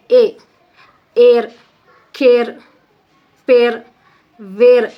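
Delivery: ए, एयर, केयर, पेयर, वेयर loud, one at a time; emphatic, separated model words ए, एयर, केयर, पेयर, वेयर; vowel sound demo. Shown as a demonstration. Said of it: "इसकी आवाज़ ए की आती".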